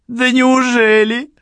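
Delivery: high loud tipsy laugh. A narrator's reading. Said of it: "засмеялась Черил".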